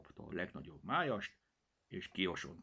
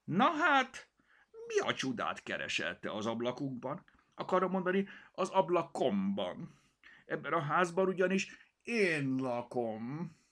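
higher, louder voice of a proud tomcat; proud house cat; 9 semitones higher, 5 dB louder than usual. Acted. Cirmi scolding the stranger kitten, indignant. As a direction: puff up and claim the window.

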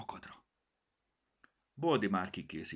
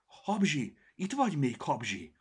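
loud, higher anxious call; worried calling; 8 semitones higher, 7 dB louder than usual. Acted. Cirmi searching the storm for the kitten, worried. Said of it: "nézett körül riadtan".

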